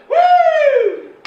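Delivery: very high, loud mock siren whoop; siren woo. Comic imitates state police siren, loud high whoop.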